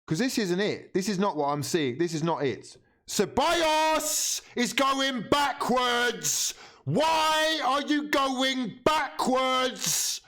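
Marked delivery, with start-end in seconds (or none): 3.1-10.2 high, slow, drawn-out shout; exasperated yell. Real, not acted. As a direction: howl it in frustration. Exasperated at backward passing.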